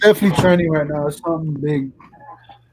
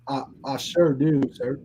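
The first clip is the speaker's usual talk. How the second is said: Forrest Gump; 5 semitones lower, 6 dB quieter than usual. Acted. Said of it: "Tony Montana meets Forrest Gump".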